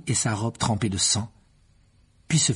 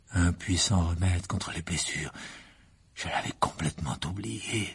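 cold sneering Riddle; 5 semitones lower, 5 dB quieter than usual. A narrator's reading. Riddle gloats over the dying Harry, low and cold. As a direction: savour every word of his victory.

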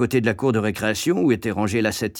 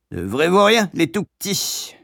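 lightly voiced grumbling woman, higher; Madame Pratchett; 7 semitones higher than usual. A narrator's reading. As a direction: grumble in a raised pitch. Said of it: "marmonnait Madame Pratchett". (mégère qui marmonne, légèrement aiguë)

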